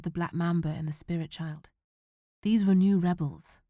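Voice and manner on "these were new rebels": ordinary delivery, nothing marked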